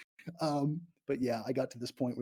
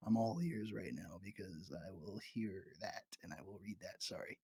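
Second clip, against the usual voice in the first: much softer hushed voice; quiet; 7 semitones lower, 12 dB quieter than usual. Real, not acted. Streamer speaking softly alone in the morgue. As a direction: drop the voice, wary of the room.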